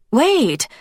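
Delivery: high, loud call to stop him; urgent call. Acted. Elsa calling to Zoltan before he leaves, high and loud. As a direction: cry out to stop him at the door.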